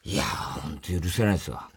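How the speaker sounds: low, subdued mock grudge; imitated sullen anger. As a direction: grumble it very low and soft, imitating an offended man. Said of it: "松岡がなんか怒ってんだよ". (低く小声で憤る真似)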